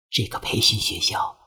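very soft, hushed warning; secretive whisper. A narrator's reading. Wary girl confiding about the school, whispered at his ear. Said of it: "凑到我耳边说".

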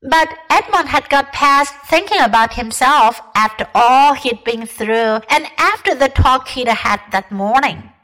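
plain reading voice

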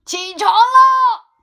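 very loud, high, drawn-out yell; shouting at the top of his lungs. Acted. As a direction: bellow it to wake the dead. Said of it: "用他最大的嗓门朝着艾米大声".

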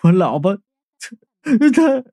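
feeble, halting words; weak. A narrator's reading. Qiangzi, knocked to the floor, speaks of his wife, weakly. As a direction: break off as if knocked down. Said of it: "虚弱地说".

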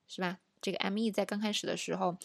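ordinary talk

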